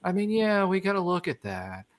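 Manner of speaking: softly droning, calming coach imitation; hushed mock-monotone reassurance; monotone coach impression. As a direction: imitate a coach lulling someone into a mind meld. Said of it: "completely monotone".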